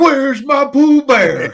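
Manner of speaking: slow, high, loud mock call; worried old man. Old man holding the bandana, slow loud call. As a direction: holler slow and worried for the dog. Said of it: "He's like".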